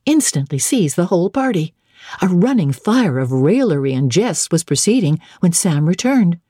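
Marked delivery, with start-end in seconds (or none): none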